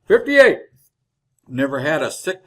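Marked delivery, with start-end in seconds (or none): 0.1-0.6 higher, louder cut-in; proud emphatic repeat; insisting. Acted. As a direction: cut him off and insist on the number. Old man cuts in, insisting.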